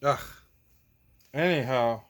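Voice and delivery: usual speaking voice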